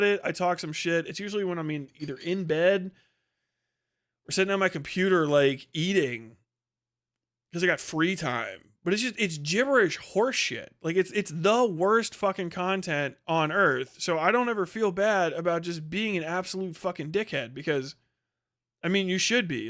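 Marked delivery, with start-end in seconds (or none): none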